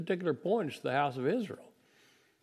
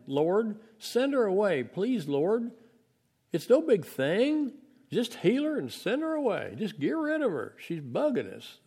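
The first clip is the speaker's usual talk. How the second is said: pleading disciples; 7 semitones higher than usual. Acted. Disciples pestering Jesus about the woman, high exasperated plea. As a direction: beg him to just send her off.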